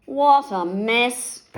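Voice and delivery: slow, loud dismayed cry; wolf surveying the chaos. A narrator's reading. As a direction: drag it out in dismay. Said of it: "cried the big bad wolf".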